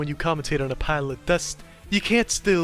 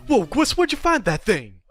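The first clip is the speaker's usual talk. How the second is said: amazed; 6 semitones higher, 4 dB louder than usual. Acted. Skit, amazed at an artifact.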